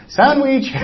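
high-pitched playful cry; child calling out. Retold or imitated. A child calls out during a group hug, playful.

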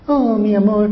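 hammed-up lover's sweet talk; mock sweet talk. Preacher mocking husband who only talks sweet. Mocking empty words of love.

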